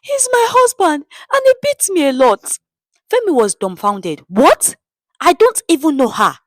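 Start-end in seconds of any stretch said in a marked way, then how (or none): 0.0-2.6 very high put-on wailing woman voice; woman wailing her complaint to the crowd; crying woman. A narrator's reading. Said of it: "the woman cried". 4.3-6.4 higher and louder, disbelieving; shocked denial. A narrator's reading.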